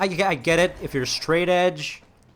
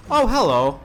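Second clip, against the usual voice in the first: surprised; 8 semitones higher, 5 dB louder than usual. Real, not acted. Spots loot suddenly.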